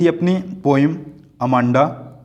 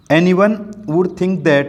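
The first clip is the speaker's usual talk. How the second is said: slow line reading; 4 semitones higher, much slower than usual. Acted. Quoting the line to explain.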